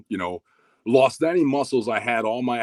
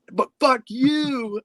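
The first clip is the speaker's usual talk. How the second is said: very high, drawn-out retort; mock defiant comeback; much slower than usual. Teen talking back to training partner, high mock defiance. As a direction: stretch the insult out high and comically.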